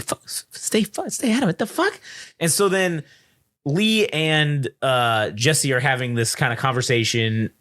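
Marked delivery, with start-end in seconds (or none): 0.0-2.0 high, incredulous mock outrage; outraged disbelief. Reporter stunned the shop girl stays out of the war, high disbelief. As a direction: spit it back in disbelief. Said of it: "He's like".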